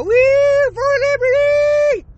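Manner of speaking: high, loud whoop, drawn-out cheer. Pretend. Vlogger whooping for Fort Liberty with a slow, high cheer. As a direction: celebrate the new name like a rally crowd.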